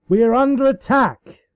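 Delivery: high, loud battle alarm; alarmed shout. A narrator's reading. Celestia shouts to the hall that they are under attack. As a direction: yell the warning to the whole castle.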